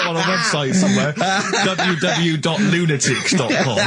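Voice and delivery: slow, spelled out through laughter; mocking the web address while laughing; laughing mockery. Host laughs at Carl's lunatic website source.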